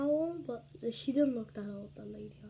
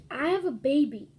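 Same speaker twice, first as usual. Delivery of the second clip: louder, higher, slowing on basket; loud retort; 4 semitones higher, 10 dB louder than usual. Retold or imitated. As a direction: shout her down on the bus. Lady's retort retold.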